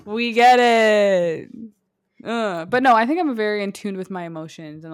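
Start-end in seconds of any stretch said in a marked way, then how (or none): 0.0-1.5 slow, dry, exasperated; dry and drawn out. Real, not acted. She cuts off her friend's joke, slow and unimpressed. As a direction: drag it out, unimpressed.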